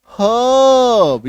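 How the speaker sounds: हर्ब very slow and high, "huuhb"; silent R. Shown as a demonstration. Teacher modelling the answer, हर्ब drawn out with silent R. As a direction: stretch हर्ब out without any R. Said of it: "इसका उच्चारण क्या हो जाएगा".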